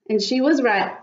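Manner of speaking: plain reading voice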